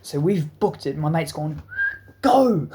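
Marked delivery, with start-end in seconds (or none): 2.2-2.7 high, loud start call; starting shout. Retold or imitated. Mate calling the race start, shouted.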